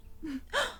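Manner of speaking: a quick startled intake of breath; sharp shocked gasp. Acted. Gasping in shock at her werewolf partner's answer. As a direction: gasp as it sinks in.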